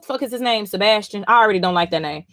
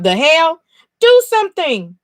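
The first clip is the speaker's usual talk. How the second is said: much higher and louder, demanding; loud outraged yell; 7 semitones higher, 6 dB louder than usual. Retold or imitated. The governor's son yelling at his soldiers, loud outrage. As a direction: shout it like an indignant onlooker. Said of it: "He talking about".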